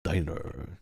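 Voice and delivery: "Diner" with an American er ending; American diner demo. Shown as a demonstration. Streamer demonstrating "Diner" for chat. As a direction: say "Diner" with the r curled at the end. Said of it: "it's pronounced diner".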